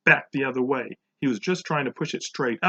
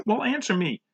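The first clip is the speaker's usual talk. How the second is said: higher, insistent demand; 9 semitones higher than usual. A narrator's reading. Teacher to silent Todd, demanding. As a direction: press for an answer, pitched up. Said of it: "she demanded".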